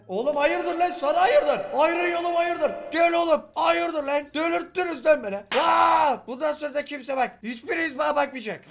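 high, loud enraged shouting; the bully exploding at the kids staring at him; furious yelling. Acted. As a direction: lose it completely and scream at them. Bully loses temper, yelling.

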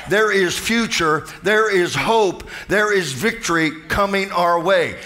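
rising preacher's cadence, slowing on each line; preaching. Acted. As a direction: build the crowd up line by line. Builds into full preaching.

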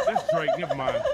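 high laughing squeal. Real, not acted. Joking about a game name.

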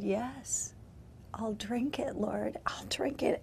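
soft, whispered yes; whispered surrender. Retold or imitated. Preacher answering the Lord, in a whisper.

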